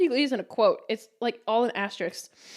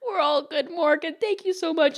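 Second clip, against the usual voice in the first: lightly voiced sweet old man, higher; John Miller; 6 semitones higher than usual. Old regular thanking his server, sweet higher voice. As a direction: thank her like a dear elderly regular. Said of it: "a really sweet old man".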